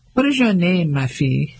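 put-on a light English accent on French words, stiff and careful; stilted anglicized French. Dora asks the girl her name in stilted English-accented French. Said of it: "in her stilted anglicized French".

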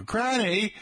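high, mock British "Granny". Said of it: "with a slight British accent".

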